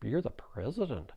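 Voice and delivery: put-on soft, stammering boy; hesitant, hushed stutter; timid little boy. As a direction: stammer it quietly, lightly voiced as an awed child. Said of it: "one of the boys goes".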